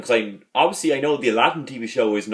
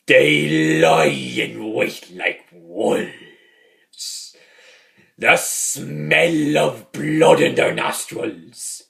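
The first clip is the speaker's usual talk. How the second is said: Jack; much slower than usual. Retold or imitated. Fan re-enacts a great Father Jack moment, slow and ominous. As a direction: draw each word out, fading into whispery dread. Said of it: "Jack does another great moment in there as well".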